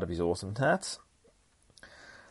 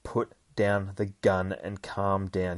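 slow, deliberate instruction; measured, slow talking-down; calming someone down; slower than usual. Retold or imitated. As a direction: space the words out to defuse things. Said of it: "tries to calm Dakin down".

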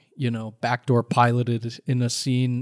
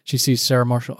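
usual speaking voice

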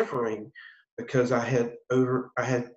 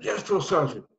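higher-pitched mock recruit reply; recruit answering sergeant; 6 semitones higher than usual. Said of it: "I said".

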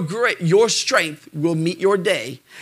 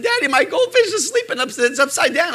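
put-on high-pitched child's voice; imitated little daughter; 9 semitones higher, faster than usual. Daughter telling Dad about her goldfish, imitated child voice. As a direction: mimic a little girl calling Daddy. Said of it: "she said".

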